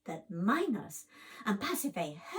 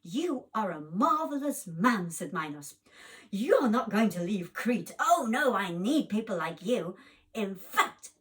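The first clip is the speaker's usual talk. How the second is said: booming king; 9 dB louder than usual. Acted. King Minos praises Polydus.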